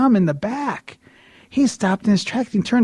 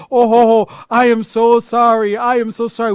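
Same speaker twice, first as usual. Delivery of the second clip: loud, raised-pitch apology; flustered apology; 5 semitones higher, 7 dB louder than usual. Retold or imitated. Man facing the interrupted preacher, loud flustered apology.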